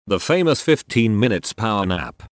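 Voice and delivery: usual speaking voice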